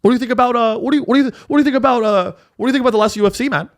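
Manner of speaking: high, fast stoner-bro voice; Joe Rogan impression. Mocking Rogan moderating a debate, high rambling bro voice. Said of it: "Joe Rogan will be like".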